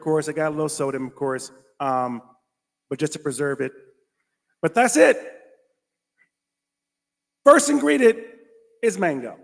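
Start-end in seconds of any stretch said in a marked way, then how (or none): 4.6-9.4 high, loud reveal; emphatic reveal. Real, not acted. Vlogger reading mango label, high emphatic reveal. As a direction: announce it like a big discovery.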